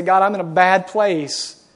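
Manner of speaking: loud, desperate cry for help; a pleading cry of someone at rock bottom; crying out to God. Pretend. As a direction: cry out to God from a bad place. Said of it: "Just cry out to Him and say".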